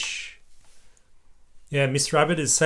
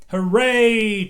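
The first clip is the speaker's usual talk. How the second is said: cheering; 9 semitones higher, 7 dB louder than usual. A narrator's reading. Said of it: "cheered Peppa".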